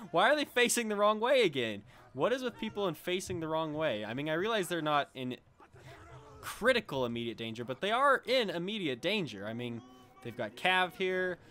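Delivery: loud, high, exasperated rant. Real, not acted. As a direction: vent at the dumb units. Units facing the wrong way again.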